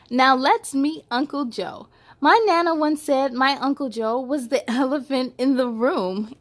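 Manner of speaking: bright read-aloud storytelling; picture book read to little kids; story narration. A narrator's reading. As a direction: read it to children, lively and clear.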